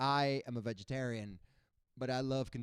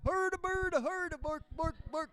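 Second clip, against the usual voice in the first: very high Muppet voice; 13 semitones higher than usual. Retold or imitated. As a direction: answer the cop like a Muppet. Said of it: "Muppet voices".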